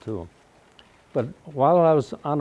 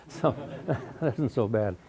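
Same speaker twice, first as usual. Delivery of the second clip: chuckling through the words; laughing; 5 dB quieter, slower than usual. Real, not acted. Old man laughing off his B grades to the audience. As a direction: laugh it off, slowing down.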